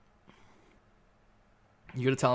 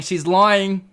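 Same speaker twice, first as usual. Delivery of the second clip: higher and louder, mock accusing; louder repeat; 7 semitones higher, 10 dB louder than usual. Real, not acted. Teasing chat, repeats the accusation much louder. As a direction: call it out to chat, louder the second time.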